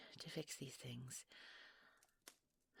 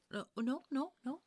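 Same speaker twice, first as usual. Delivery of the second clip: alarmed hushing; 9 semitones higher, 7 dB louder than usual. Acted. Child wakes, she hushes.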